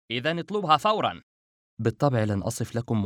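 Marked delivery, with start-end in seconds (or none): 0.1-1.2 higher-pitched, urgent instruction; grave urgency. Acted. Narrator urging his companion to phone at once, gravely urgent. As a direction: press for action, voice lifted. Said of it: "وقلت بخطورة".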